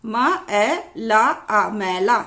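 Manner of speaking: very slow, separate syllables; slow spelling-out. Shown as a demonstration. Teacher spelling an example for learners, slow spelling-out. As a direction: draw out each sound before the word.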